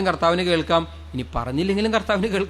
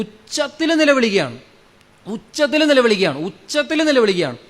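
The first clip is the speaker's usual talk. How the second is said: dramatised crying out; 5 semitones higher than usual. Retold or imitated. Preacher telling how blind beggars cried out, raised pitch. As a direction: stretch it high, evoking their cries.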